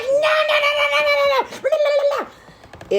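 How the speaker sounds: very high squealed tantrum; hammed-up freak-out tumbling into gibberish; mock flipping out. Host imitating Clara Sue's reaction to the will, mock squealing tantrum. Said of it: "Clara Sue flips the fuck out".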